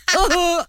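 high, hammed-up wailing sobs; mock boo-hoo meant to be overheard; loud fake crying. Wife crying so husband can hear her, loud fake sobs. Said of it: "I start crying loudly".

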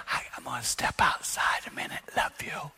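gentle whisper, tender sign-off. Retold or imitated. Husband stepping outside to calm down, whispered. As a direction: whisper it to stay in control.

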